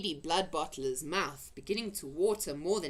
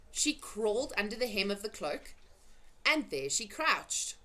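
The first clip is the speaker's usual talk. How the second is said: ordinary delivery, nothing marked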